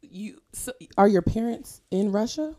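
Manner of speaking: normal talking voice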